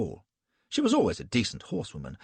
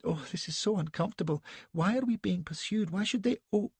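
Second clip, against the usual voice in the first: put-on high-pitched, petulant, breathless young lady; a shaken, indignant society wife; Elsbeth, fluttery young wife; 10 semitones higher than usual. A narrator's reading.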